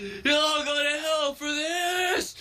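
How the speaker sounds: high, drawn-out acted shout. Boy being forced out of the classroom, shouting. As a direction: shriek the curse slowly, stretching it out. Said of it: "while he shouts that".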